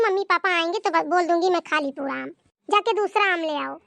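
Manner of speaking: ordinary talk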